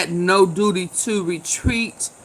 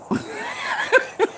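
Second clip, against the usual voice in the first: high, soft wheezing laugh; breathy laugh; 7 dB quieter than usual. Real, not acted. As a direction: laugh silently through the breath. Tipsy host cracking up at chat.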